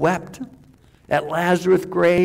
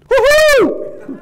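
a high, loud whoop with a laugh; joyful whoop; 7 dB louder than usual. Acted. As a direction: cheer like a man set free. Preacher acts Paul's joy at dying.

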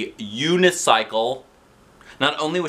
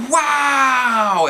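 high, loud amazed shout; kids' wow; 6 dB louder than usual. A narrator's reading. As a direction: cry out in wonder. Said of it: "everybody said again".

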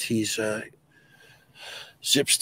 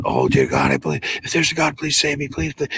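hurried, pleading mock prayer; imitated desperate praying; 6 semitones higher than usual. Terrified camper begs God to save him, praying desperately. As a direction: beg for rescue, words tumbling out. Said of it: "Starts praying".

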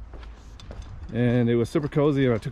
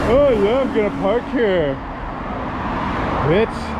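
raised voice, noise-cancelling headphones on; talking over headphones; 6 semitones higher than usual. Real, not acted. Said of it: "I got the noise canceling on".

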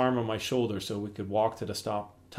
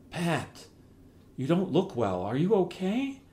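worried; 7 semitones higher than usual. Acted. Mom worried about weak dad.

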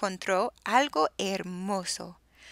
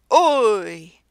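sudden loud startled "Uy"; startled yelp; 12 dB louder than usual. Acted. Comp yelps in fright as the tyrannosaur appears, loud. Dinosaur startled, drops the egg.

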